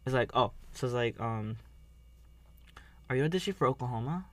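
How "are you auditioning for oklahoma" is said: rushed quote. Retold or imitated. Quoting show dialogue.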